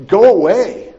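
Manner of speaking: loud shouted go away; demon crying out. Acted. Possessed man crying out at Jesus in the synagogue, a loud shout. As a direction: cry it out at the top of the voice. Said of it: "He cried out at the top of his voice".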